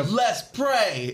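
high, swooping hype yell; stretched-out high catchphrase cry; hype catchphrase shout. Acted. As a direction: holler it like a hype man. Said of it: "he's like a".

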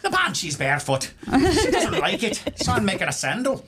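high, laughing cartoon Irish leprechaun; giggly hammed-up Irish accent. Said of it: "forgive my, uh, Irish accent".

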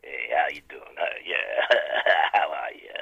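very low, hushed croak; not announcer. Acted. Caller greets the hosts after announcer intro, low hushed voice. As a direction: drop to a soft, deep near-whisper. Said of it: "to, like, not announcer".

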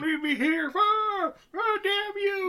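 high frantic pleading yell; desperate yelling captive. Retold or imitated. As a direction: shout after her, stuck and helpless. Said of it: "And he's like".

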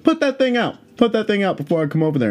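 high-pitched mock police order; bossy cop. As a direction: imitate an officer issuing a command, quickening as it goes. Imitates cop giving an order.

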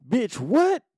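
retold outburst, high and loud; loud disbelief. Brother hears of drugs in car, loud disbelief. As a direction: burst out in shocked disbelief. Retold shock at sister's confession.

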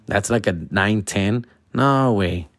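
usual speaking voice

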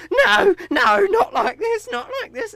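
shrieking, crying in terror; terrified scream. Acted. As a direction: scream it in panic, very high. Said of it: "the last lady just screams".

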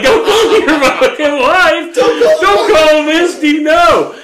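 high, slow, laughing shout; laughing, pleading. Retold or imitated. Pleading with the cop not to call the wife, laughing shout. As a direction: beg them not to, cracking up. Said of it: "I'm like".

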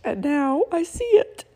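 slow, high and tearful; crying. Real, not acted. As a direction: let the voice break on the words. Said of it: "sorry about the cry".

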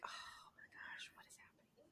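whispered, breathless disbelief; stunned whisper. Retold or imitated. Fan reliving a nail-biting inning, whispering in disbelief. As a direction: hush it like you can't bear to watch. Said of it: "I'm like".

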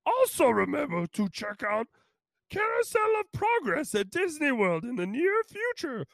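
high, strained little creature voice; Yoda. Acted. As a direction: squeeze it up high and odd, ending on a little hum. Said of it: "my best Yoda impression".

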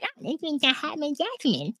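high, soft put-on voice; mock him in a small high mumble; mimicking Gino. Imitating Gino on the date.

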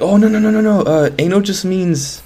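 high mock-panicked backpedalling; flustered cover-up. Main character covers for the drummer, mock flustered. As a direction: imitate someone hastily explaining it away.

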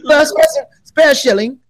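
high, put-on posh English voice; mock English accent. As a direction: ham up a posh Brit through laughter. Mocking English posh speech.

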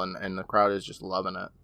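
ordinary talk, nothing marked

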